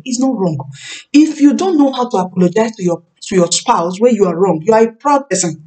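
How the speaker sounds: normal talking voice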